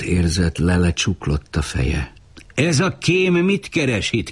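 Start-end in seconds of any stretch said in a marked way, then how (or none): 2.5-4.3 a sudden shrill cry, pitched way up; high-pitched shout. A narrator's reading. A stranger among the elders yells at Uri in a high voice. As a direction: someone yelling out in a high voice. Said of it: "kiáltotta valaki magas hangon".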